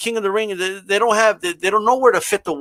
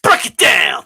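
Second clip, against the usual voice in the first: wrestling catchphrase call; 4 dB louder than usual. Retold or imitated. Quoting DX entrance catchphrase.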